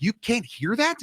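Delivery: high, slow disbelief; incredulous visitor. Retold or imitated. Visitor amazed he can't hear the train, slow and high. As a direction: stretch it out, amazed.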